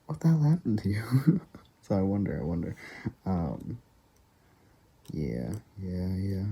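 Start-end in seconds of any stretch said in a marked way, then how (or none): 0.1-1.4 high, mock-shocked exclamation; shocked nurse. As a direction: imitate someone stunned by the change. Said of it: "she's gonna come back and be like".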